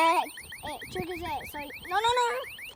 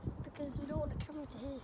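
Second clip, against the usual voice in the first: whispering; 2 semitones lower, 12 dB quieter, faster than usual. Real, not acted. Explains the whisper, hushed.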